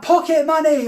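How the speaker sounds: high-pitched rallying call; demonstrated shout. Shown as a demonstration. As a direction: lead the crowd in a chant. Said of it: "Everyone shout".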